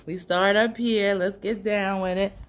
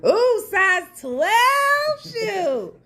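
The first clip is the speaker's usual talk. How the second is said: slow, high, teasing purr; flirty drawn-out ooh; 12 semitones higher, 5 dB louder, much slower than usual. Real, not acted. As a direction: savor the words slowly. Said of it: "I love a good twelve".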